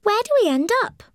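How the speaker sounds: put-on high, quick, eager boy's question; eager little boy. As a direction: blurt it out like an anxious child. Tommy asks anxiously.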